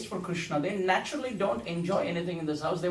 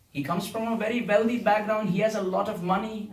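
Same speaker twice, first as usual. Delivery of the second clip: usual speaking voice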